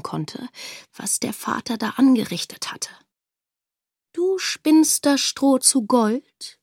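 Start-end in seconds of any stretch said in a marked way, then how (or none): 4.1-6.6 slow, drawn-out skeptical question; a king questioning a girl, slow and doubtful; doubting king. Acted. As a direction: weigh each word, unconvinced. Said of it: "fragte der König".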